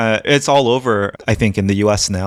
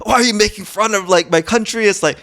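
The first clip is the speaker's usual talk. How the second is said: put-on very high-pitched French-accented protest; hammed-up French accent, ranting; mock French accent; 12 semitones higher than usual. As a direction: imitate an offended Frenchman, pitch way up. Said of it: "was that a French accent".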